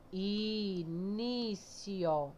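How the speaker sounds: drawn-out teacher's split of INÍCIO; separated syllables. Shown as a demonstration. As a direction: stretch each syllable of Início right out. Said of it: "Vamos separar início".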